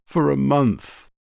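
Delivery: slow, broken repeat. A narrator's reading. Anne repeating her loneliness to Star, slow and choked. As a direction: let the repeat drag as it breaks. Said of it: "She made a choking sound".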